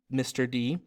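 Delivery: usual speaking voice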